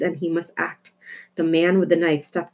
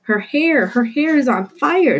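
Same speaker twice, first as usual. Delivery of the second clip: alarmed; 7 semitones higher, 4 dB louder than usual. Acted. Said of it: "someone answered".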